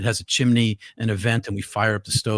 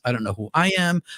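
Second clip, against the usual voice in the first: ordinary delivery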